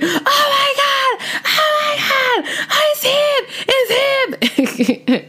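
very high, frantic squeal; excited screaming. Retold or imitated. Screaming to a friend in the car as his call comes in, frantic squeal. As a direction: reenact losing it when his name pops up. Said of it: "Literally, that was me in the car".

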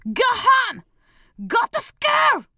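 put-on high, slow, furious kid yelling; angry child shout. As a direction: snap at the speaker like an angry child. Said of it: "とキレていた".

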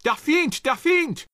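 panicked sentry's shout; shouted alarm. A narrator's reading. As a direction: yell the warning at the sentries. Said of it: "they turned and shouted alarm".

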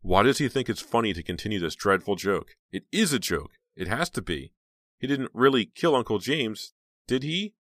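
loud, high, agitated questioning; desperate disbelief. A narrator's reading. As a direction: insist it loudly, climbing to a pleading question. Heroine's desperate disbelief, voiced.

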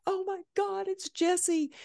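high, breathless fan squeal; starstruck gasp. Retold or imitated. Seeing Jesse arrive backstage, a high starstruck squeal.

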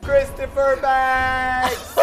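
high shrieked store name; screamed last words. Pretend. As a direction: shriek it like you're falling. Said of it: "Mine would be like".

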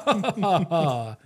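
hammed-up pointed "ha ha"; exaggerated, taunting fake laugh; mock laughter. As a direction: laugh mockingly in someone's face. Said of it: "point and laugh at them".

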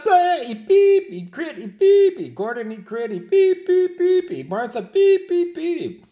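high, loud bleeped-out shouting; yelling chef. Acted. Gordon yelling at the critic, high furious bleeped rant. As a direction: rage with pip for swear words. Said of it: "Y Gordon grita".

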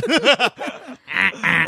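high put-on laugh; exaggerated fake cackle. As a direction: force out a mock laugh. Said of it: "God, the fake laugh".

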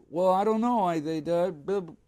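high, fumbling mock excuse; awkward dodge. Asked about the missing record, high embarrassed mumble. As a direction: squirm out of the question.

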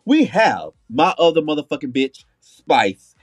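ordinary talk

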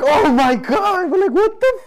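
high, slow, drawn-out disbelief; high stunned "oh my God"; shocked exclaim. Retold or imitated. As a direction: gasp it out in shock, stretched. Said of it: "we're all like".

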